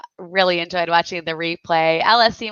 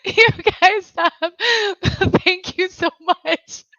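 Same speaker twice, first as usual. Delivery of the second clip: high, helpless words squeezed through laughter; weak with laughter, voice pitched up; laughing to tears; 9 semitones higher than usual. Real, not acted. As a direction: try to say thanks while cracking up. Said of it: "I'm crying".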